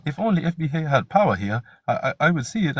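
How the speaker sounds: mocking imitation of Chaka; mocking quote. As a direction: parody the other guy's claim. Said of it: "He come up here talking about".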